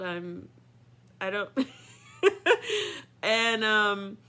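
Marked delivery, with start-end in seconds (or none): 2.2-3.2 loud embarrassed laugh. Real, not acted.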